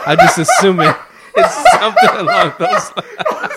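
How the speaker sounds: loud, squealing laugh through the words; cracking up at your own bad accent; real high-pitched helpless laughter while talking; laughing hard. Real, not acted.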